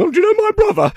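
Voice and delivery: squeaky mock question; a high, silly voice as a joke. Imagined flop of an audition impression, squeaky and high. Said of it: "if I'd have just gone".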